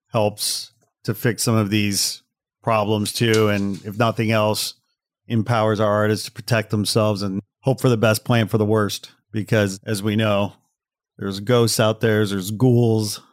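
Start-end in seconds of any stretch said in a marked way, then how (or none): none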